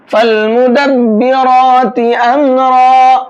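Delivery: slow, drawn-out melodic tilawat; Quran recitation. Shown as a demonstration. Teacher opens the lesson reciting slowly for students. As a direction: chant each word slowly, stretching the vowels. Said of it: "فا کو لام میں ملائیں گے".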